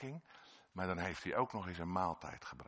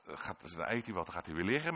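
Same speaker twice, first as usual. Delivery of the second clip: normal talking voice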